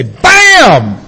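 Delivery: loud high chef's Bam!; Emeril's Bam. Retold or imitated. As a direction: punch it like a TV chef. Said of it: "if they were Emeril Lagasse".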